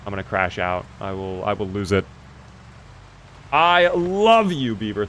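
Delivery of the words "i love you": "love" stretched long and high; stress on love. Real, not acted. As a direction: draw out the word "love" slowly with a higher pitch. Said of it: "the emphasis on the love".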